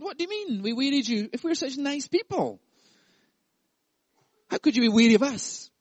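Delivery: high, indignant whine; mock innocent people. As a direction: act baffled and hurt. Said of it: "the people were saying to God".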